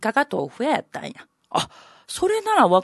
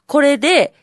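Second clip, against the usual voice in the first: ordinary delivery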